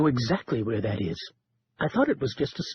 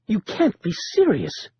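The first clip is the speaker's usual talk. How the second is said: high, aghast cry of his name; shocked librarian, higher voice; shocked protest; 7 semitones higher than usual. Acted. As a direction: recoil at the idea in alarm. Caleb shocked at burglary plan.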